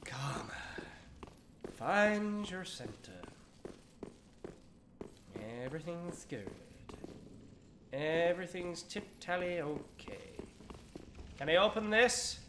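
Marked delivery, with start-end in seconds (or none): 0.0-10.3 soft, drawn-out soothing murmur; hushed zen self-soothing; calming oneself. Real, not acted. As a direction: talk yourself down, slow and quiet. Calming themselves down after panic.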